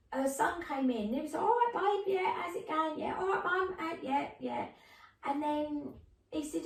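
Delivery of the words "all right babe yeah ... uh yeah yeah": cheery son. Retold or imitated.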